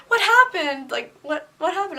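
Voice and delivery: high, loud alarmed teacher asking; a teacher suddenly worried for her student; concerned teacher. Retold or imitated. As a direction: mimic the startled teacher's concern. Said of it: "she's like".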